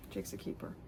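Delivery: voiceless whisper repeat. Shown as a demonstration. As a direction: breathe it like a secret.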